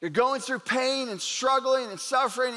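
ordinary delivery

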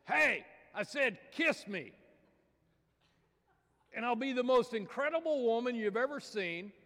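high, loud squeaky frog; pushy cartoon frog voice; impatient frog. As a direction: demand it as a tiny impatient frog. Frog insists on a kiss.

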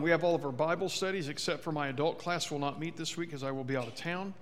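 ordinary talk

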